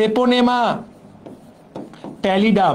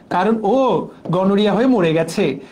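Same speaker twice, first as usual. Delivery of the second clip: ordinary talk, nothing marked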